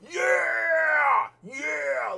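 high, drawn-out mock cheer; imitated hype-man yell. Host imitates a guy at the presentation, high yelling. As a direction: holler it like an overexcited man in the background. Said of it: "guy who was in the background just going".

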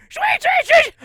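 very high, loud piglet squeals; mock animal squeals. Imitating Piglet being attacked by the bear, frantic squealing. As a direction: squeal in short piercing bursts. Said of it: "And then you'll hear".

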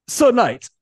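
sharp, high, loud call; shout. A narrator's reading. Lieutenant stopping the raging knight, urgent shout. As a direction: shout to snap him out of it. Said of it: "the salamander's lieutenant shouted".

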